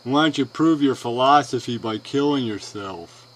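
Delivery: mocking quote. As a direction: parody a dumb challenge, loudly. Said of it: "you'll challenge them with bullshit like".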